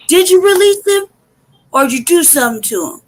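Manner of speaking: accusing challenge. Acted. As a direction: challenge their story outright. Challenging the officers' claim.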